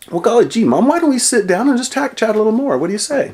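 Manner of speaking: high, bright golly-gee cheer; wholesome 1950s kid. Acted. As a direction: play it like a gee-whiz kid from an old sitcom. Son hams folksy chat to mom.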